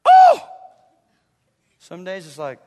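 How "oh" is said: very high, loud "oh"; ecstatic mock shriek. Preacher acting thrilled by God's word, shrieked mock ecstasy. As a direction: squeal in put-on rapture.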